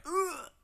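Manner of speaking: high-pitched pretend groan; short, high, comic "ugh"; mock groan. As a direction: imitate a man toppling over with a groan. Punched man falls over, groans.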